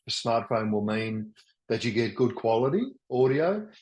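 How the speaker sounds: ordinary talk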